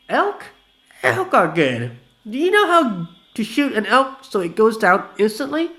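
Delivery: high, loud eager voice; perked-up drunk. Acted. Vanilla eager about elk hunting, high loud voice.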